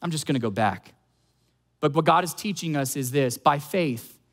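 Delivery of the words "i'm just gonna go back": low, subdued voice of defeat; resigned giving up. Acted. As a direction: act like throwing in the towel. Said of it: "we feel like throwing in the towel".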